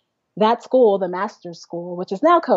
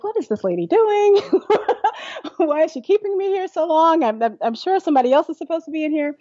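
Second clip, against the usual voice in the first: much higher, half-laughing disbelief; bewildered inner voice; 8 semitones higher than usual. Retold or imitated. Teenage singer inside her head, higher baffled amusement. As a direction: wonder aloud what this lady is up to. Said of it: "And I'm like".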